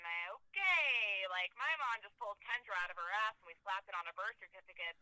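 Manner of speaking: normal talking voice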